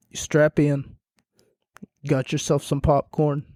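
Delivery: ordinary talk, nothing marked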